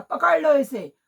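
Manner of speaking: loud royal command; shouted order. A narrator's reading. King shouting at his soldiers: loud command. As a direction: bark it out to the soldiers.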